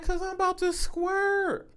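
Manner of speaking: high, soft, drawn-out mimicry; imitated woman. Man retelling a partner's request, imitated woman. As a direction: imitate her slowly in a high voice.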